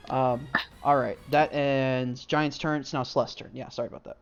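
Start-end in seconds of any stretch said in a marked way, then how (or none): none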